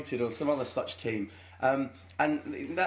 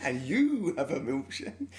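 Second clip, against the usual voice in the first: slow menacing drawl, breaking into a laugh; a lightly voiced sinister oilman, laughing; Daniel Day-Lewis impression; 5 semitones higher than usual. Retrying the milkshake line for Joel, slow and laughing. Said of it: "impression of Daniel Day-Lewis".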